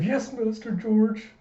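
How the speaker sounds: bow and answer like a frail servant; old butler. Retold or imitated. Old butler answering his young master, slow and doddering.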